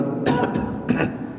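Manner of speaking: a slow, self-important throat cough; rich man's cough. Shown as a demonstration. Preacher shows the congregation how a rich man coughs. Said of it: "Batuknya beda orang yang punya duit".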